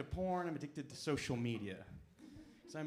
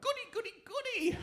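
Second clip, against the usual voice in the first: put-on very high squeaky old-lady glee; delighted grandma; 4 dB louder than usual. Grandma thrilled by the chatbot's answer, very high gleeful voice. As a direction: clap your hands like a thrilled granny. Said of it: "my grandma would have gone".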